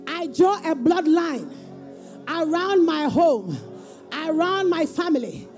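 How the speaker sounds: high, slow fervent preaching; booming motivational speech. Real, not acted. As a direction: drive each declaration home. Leads congregation in declarations.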